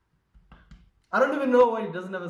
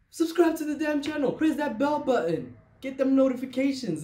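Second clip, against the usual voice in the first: hyped-up urging; 8 semitones higher, 4 dB louder than usual. Real, not acted. Urging viewers to subscribe.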